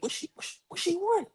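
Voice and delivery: breathy whisper that ends in a high squeak; mock whisper. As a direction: hiss the question under your breath. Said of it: "trying to whisper".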